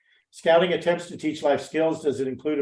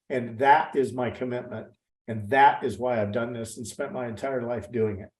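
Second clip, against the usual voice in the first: ordinary talk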